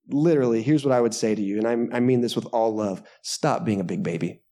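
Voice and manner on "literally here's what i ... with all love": normal talking voice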